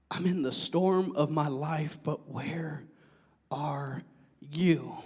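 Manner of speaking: soft, low plea to God; hurting prayer. Acted. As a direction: pray quietly from inside hardship. Voices a hurting believer's prayer.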